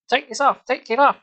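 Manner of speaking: high, loud frantic scream; high panicked shout. Acted. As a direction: scream it out, losing control. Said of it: "she screamed, losing control".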